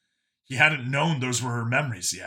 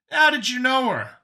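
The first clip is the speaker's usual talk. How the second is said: high, louder question; Brian, asking; 13 semitones higher, 6 dB louder than usual. Acted. Brian asking Vincent about Catherine, high and urgent. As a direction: pitch it high and pressing. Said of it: "he could hear Brian ask".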